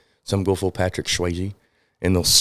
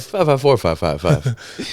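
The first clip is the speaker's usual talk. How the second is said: infomercial voice; 3 semitones higher, much slower than usual. Pretend. Mock infomercial phone number.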